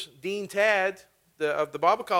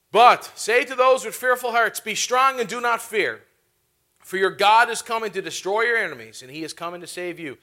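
preaching the verse; 3 semitones higher, 6 dB louder than usual. Real, not acted. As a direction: declare it boldly to the congregation. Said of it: "repeated with force".

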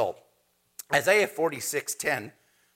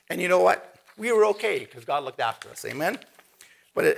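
ordinary talk, nothing marked

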